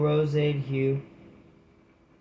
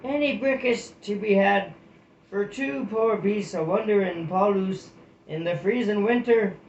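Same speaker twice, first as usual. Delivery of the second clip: calling out from afar; 6 semitones higher than usual. Acted. Cold traveller calls up to the abbey walls, pleading. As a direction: call up to the wall like a cold traveller. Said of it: "a cry rang up".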